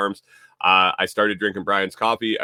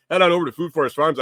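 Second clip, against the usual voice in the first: excited pitch; 8 semitones higher, 5 dB louder, much faster than usual. Real, not acted. Coffee plug call to action.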